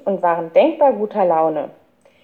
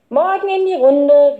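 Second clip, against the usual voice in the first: high, louder sing-out hello; called-out greeting; 8 semitones higher, 5 dB louder than usual. A narrator's reading. Girl greeting the boys in the yard, calling out. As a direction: sing it out to the whole group. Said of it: "rief sie".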